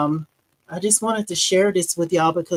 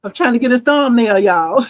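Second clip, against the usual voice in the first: laughing excitedly; 5 semitones higher, 8 dB louder than usual. Real, not acted. Delighted, laughing at her work.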